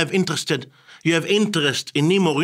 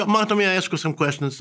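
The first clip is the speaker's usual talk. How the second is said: light, higher-pitched casual ask; relaxed friendly request; 5 semitones higher than usual. Shown as a demonstration. Interviewer to an interviewee already laughing, friendly and casual. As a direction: slip the request in easily, high and chatty. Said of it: "you start laughing with the jokes".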